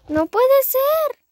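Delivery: high, loud, slow dismay; dismayed wail. Real, not acted. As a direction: stretch it out in disbelief. Repeated, drawn out and loud. (lamento alto y lento)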